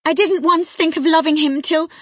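high, loud tearful protest. A narrator's reading. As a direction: protest through tears. Cathy still sobbing, cut off.

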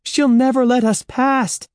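high, loud wail of alarm; panicked cry. A narrator's reading. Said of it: "Andy cried".

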